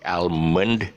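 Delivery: "Almond" as AL-mund; L sounded. Shown as a demonstration. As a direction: mistaken L in "Almond". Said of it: "a very common mistake".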